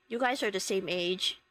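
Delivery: low, embarrassed brother imitation. Brother telling two girls about their age, embarrassed. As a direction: drop your pitch, quoting a mortified brother. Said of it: "my brother embarrassedly goes".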